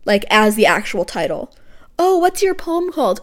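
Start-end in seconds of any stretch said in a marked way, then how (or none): 2.0-3.2 high-pitched imitation of someone asking; mock questioner. Podcaster mimicking someone asking about her poem, high mock voice. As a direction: put on a high, curious mock voice. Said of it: "like, pretentiously name a poem".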